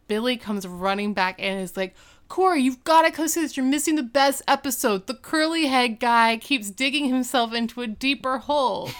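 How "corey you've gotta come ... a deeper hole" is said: excited call. Retold or imitated. Billy runs back excitedly.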